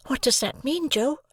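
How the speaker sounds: put-on a little boy asking what it means; boy Pip asks Joe, high and puzzled; young boy Pip. A narrator's reading.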